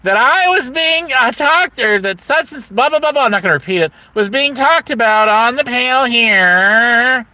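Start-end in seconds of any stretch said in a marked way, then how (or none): 0.0-3.2 exaggerated high mock quote; mocking imitation. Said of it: "she got up there saying". 4.1-7.3 higher, drawn-out mimicry; exaggerated complaining impression; mocking imitation. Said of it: "she got up there saying".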